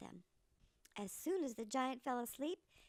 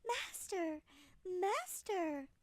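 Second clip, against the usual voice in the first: higher, urgent calls; harp's cry; 6 semitones higher, much slower than usual. Acted. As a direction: cry out in alarm for help. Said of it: "it screamed".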